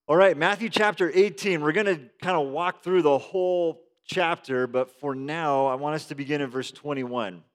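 ordinary talk, nothing marked

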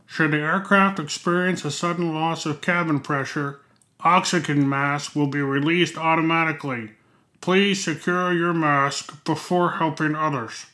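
lightly voiced cabin announcement; flight attendant. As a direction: read it like an airline safety notice. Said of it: "you've heard the announcement".

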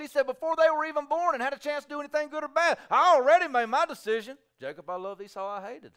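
high, loud, fast proclamation; preaching at full force. Acted. Preacher thundering God's decision to the congregation. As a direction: preach it out to the crowd.

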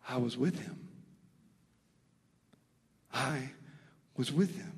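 softly, I was with him; quiet awe, stress on with; with said soft and lifted; hushed realization. Real, not acted.

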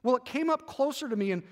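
measured book-reading narration; story read aloud. Acted. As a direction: read the chapter to the group like a bedtime story. Reads the book chapter aloud.

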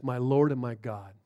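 low, very soft awe; hushed confession. Retold or imitated. Thomas recognizes risen Jesus, hushed. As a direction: let it out under the breath.

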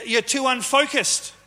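usual speaking voice

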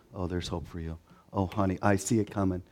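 soft, low, soothing reassurance; hushed mock consolation; faith-filled hoper. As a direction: play the gentle, ever-hopeful comforter. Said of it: "faith-filled hopers among us".

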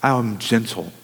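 gentle, hushed. Acted. Speaking as gentle Jesus, hushed.

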